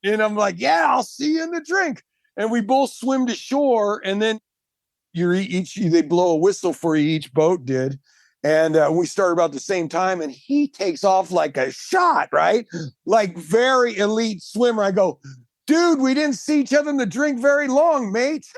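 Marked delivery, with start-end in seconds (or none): 0.6-2.0 high-pitched, enthusiastic retold comeback; imitating an upbeat holler back; eager shouted reply. Echoing the Australian's shouted greeting. 15.7-18.5 high-pitched mock call to a mate; playful imitated send-off; joking retort. Teasing retort to Australian, then laughs.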